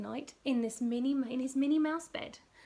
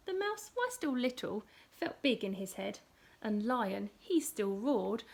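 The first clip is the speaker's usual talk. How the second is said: plain reading voice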